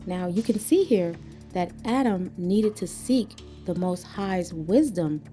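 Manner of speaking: normal talking voice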